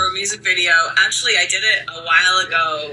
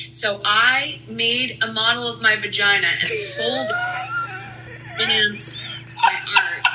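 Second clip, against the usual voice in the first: laughing; 3 semitones higher than usual. Real, not acted. Laughs at her own story.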